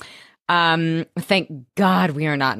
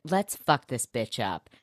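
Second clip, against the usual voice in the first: mock tough quote; 5 semitones lower, 7 dB quieter, slower than usual. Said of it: "and then we get".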